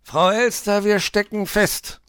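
high, loud grumbling complaint; scolding fox. A narrator's reading. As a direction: scold loudly as a storybook fox. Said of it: "schimpfte Herr Fuchs". (schimpfender Fuchs, hoch und laut)

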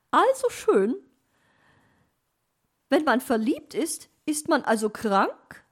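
high, loud puzzled question rising; surprised magpie. A narrator's reading. Magpie surprised that love is an illness, high and loud. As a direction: ask it high, amazed. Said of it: "meinte sie überrascht".

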